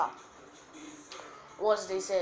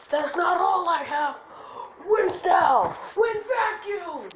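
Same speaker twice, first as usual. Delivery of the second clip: high, loud anime attack shout; battle cry; 11 semitones higher, 9 dB louder, slower than usual. Acted. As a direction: yell the jutsu name, last words drawn out. Acted defiance and attack call.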